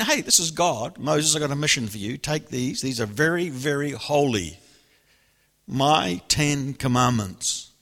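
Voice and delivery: grand, commanding voice of God handing over the tablets; God's voice. Acted. Preacher voices God commanding Moses.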